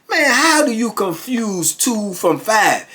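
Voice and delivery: high, drawn-out mock disbelief; exasperated comeback. Chess winner answering a denying opponent, high exasperated re-enactment. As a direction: stretch it out slowly, high and incredulous. Acted winner arguing back over score.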